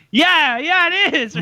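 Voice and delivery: high-pitched, eager "yeah"; mimicking an excited friend; eager reply. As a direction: pipe up excitedly, voice jumping high. Acted eager reply to passing fans.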